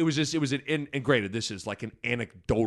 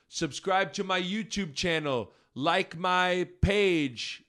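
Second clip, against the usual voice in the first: higher, drawn-out mock American YouTuber accent; imitated American accent; 7 semitones higher, much slower than usual. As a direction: put on a slow, high-pitched fake American accent. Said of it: "it was weird to hear a lot of their American accents".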